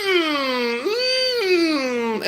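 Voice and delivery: very high whimpering hum; Skeksis. Pretend. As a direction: whimper like a fantasy creature, drawn out. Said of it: "they were like".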